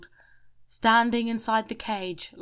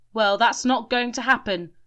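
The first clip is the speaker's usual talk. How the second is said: loud, higher defiance; defiant; 4 semitones higher, 7 dB louder than usual. A narrator's reading. Shelley defying the men, loud and high. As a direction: refuse with defiance.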